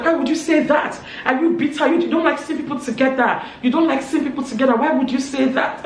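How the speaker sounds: high, loud challenge; indignant accusation. Retold or imitated. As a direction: re-enact an offended confrontation. Acted accusation in a retold quarrel.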